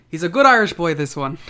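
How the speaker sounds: a put-on Irish lilt, a Canadian trying to sound Irish; [name]'s Irish accent. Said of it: "attempt [name]'s attempt at an Irish accent".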